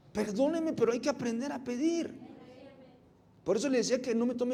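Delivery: loud, high, rising preacher's proclamation; fiery preaching. Acted. Pastor proclaiming abundance to the congregation, loud preaching. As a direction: proclaim it like a revival pulpit.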